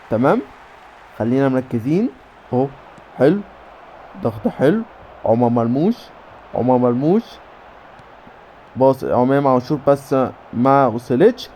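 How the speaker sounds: usual speaking voice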